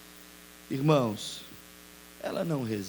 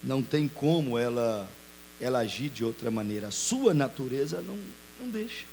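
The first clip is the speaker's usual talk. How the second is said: ordinary talk, nothing marked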